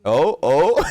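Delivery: loud high laughing fit; real burst of laughter. Real, not acted. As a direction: crack up at the play. Reacts to a play on stream.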